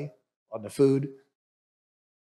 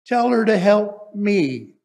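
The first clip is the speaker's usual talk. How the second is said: loud, higher and slow, aggrieved; indignant complaint; 3 semitones higher, 7 dB louder, slower than usual. Acted. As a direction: demand it like an overworked sister. Martha complaining about her sister.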